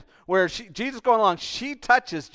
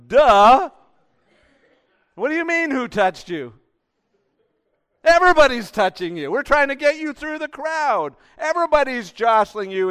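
loud, high-pitched, incredulous protest; hammed-up exasperation, pitch shooting up; exasperated Peter; 10 semitones higher, 10 dB louder than usual. Peter protesting to Jesus in the crowd, exasperated shout.

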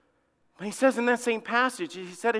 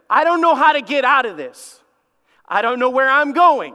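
raised, higher desperate lament; lost cry; 5 semitones higher, 7 dB louder than usual. Pretend. Preacher voices a lost person's desperate cry, loud and high. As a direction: plead like someone who is lost.

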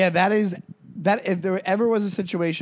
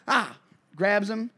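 ordinary talk

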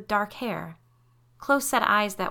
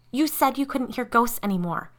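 accusing, higher-pitched hiss; 5 semitones higher, 4 dB louder than usual. A narrator's reading. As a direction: throw it at her as a tense accusation. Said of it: "Curie hissed".

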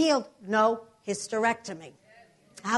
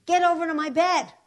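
loud, higher-pitched order; firm command; 7 semitones higher, 6 dB louder than usual. Retold or imitated. Patient summoning roommate for prayer, loud command. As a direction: call her over forcefully.

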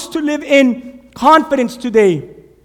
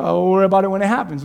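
carefree; 5 semitones lower, much faster than usual. Retold or imitated. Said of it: "He's like".

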